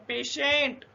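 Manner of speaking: पेशेंट drawn out with SH in the middle; पेशेंट read out distinctly as a pronunciation example; demonstrated word. Shown as a demonstration. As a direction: model पेशेंट carefully, stretching the second syllable. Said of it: "यहाँ पे पढ़ के देखिए".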